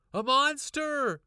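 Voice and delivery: high, loud, slow cry of alarm; bursting into the kitchen, loud and scared. A narrator's reading. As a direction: shriek the word out. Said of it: "I cried".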